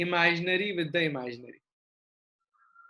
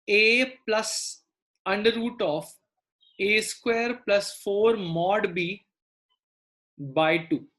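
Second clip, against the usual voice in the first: slow dictation; slower than usual. Real, not acted. Teacher dictating formula slowly.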